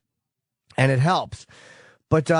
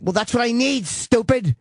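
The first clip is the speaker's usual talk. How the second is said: higher angry retort, mock yell; 8 semitones higher than usual. Man retelling his angry reply to the tech, yelling. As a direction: snap back at the tech, pitched up. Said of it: "Why am I yelling?".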